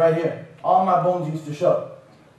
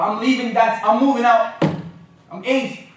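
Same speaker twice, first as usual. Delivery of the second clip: raised, pitched-up mock defiance; defiant kid; 5 semitones higher, 4 dB louder than usual. Eight-year-old telling his mom he's moving out, mock defiant. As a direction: announce it like a rebellious child.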